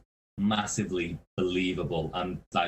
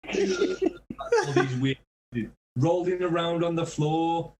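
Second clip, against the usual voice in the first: high, slow, laughing through words; 8 semitones higher, slower than usual. Real, not acted. Telling friends about the fan, breaking into laughter. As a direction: crack up describing it.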